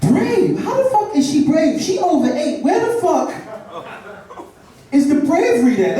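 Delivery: high, disbelieving outrage; incredulous. Retold or imitated. Comic scoffing at fans who call her brave.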